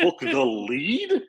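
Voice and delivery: high, drawn-out incredulous question; jealous disbelief. Retold or imitated. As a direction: stretch it upward in mock outrage. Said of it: "continued to be jealous".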